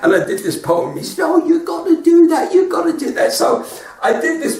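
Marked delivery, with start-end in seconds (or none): 1.2-3.3 excited urging. Retold or imitated. Joe Pasquale urging in a story.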